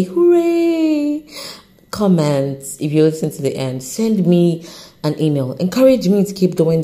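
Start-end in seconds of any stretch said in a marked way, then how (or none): 0.0-1.3 high, very slow hooray; drawn-out hooray. Real, not acted. To listeners who stayed to the end, a slow high cheer. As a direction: stretch out the cheer.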